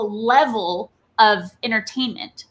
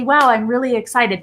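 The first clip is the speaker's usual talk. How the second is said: flat, stiff corporate tone with no excitement; flat professional. Shown as a demonstration. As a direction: say it as if reading a memo. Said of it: "trying to be very professional and I say".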